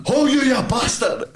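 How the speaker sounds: high mock yell at the intruder; half-laughing imitation of a furious shout; papa's retold shout. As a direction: bellow it like an angry papa bursting in. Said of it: "as he's shouting at me".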